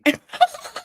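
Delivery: high shrieking laugh-cry. Real, not acted. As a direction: let out a wailing laugh. Laugh-cry after joke.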